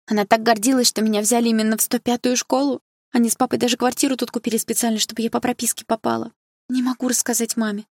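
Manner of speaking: put-on troubled girl unburdening herself; distressed schoolgirl. A narrator's reading. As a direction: explain it plaintively, pitch held high.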